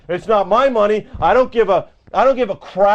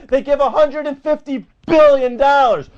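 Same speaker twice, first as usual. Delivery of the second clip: normal talking voice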